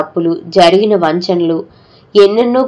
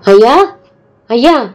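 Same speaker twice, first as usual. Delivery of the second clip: loud, higher wailing call to the master; wailing cry; 4 semitones higher, 4 dB louder than usual. Acted. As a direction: wail over the dead master. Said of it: "గట్టిగా అరుస్తూ ... ఏడవసాగాడు". (గట్టిగా ఏడుస్తూ పిలుపు)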